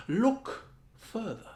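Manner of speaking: lightly voiced wise old friend, drawn out; gentle slow hint of the tree-king speaking; Friedrich's imagined voice. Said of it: "Friedrich seemed to be saying".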